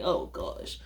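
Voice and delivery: very low, hushed mock dismay; dismayed aside. Dreading how the waiter will act, a hushed dismayed aside. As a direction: say it under your breath, sinking. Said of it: "I was like".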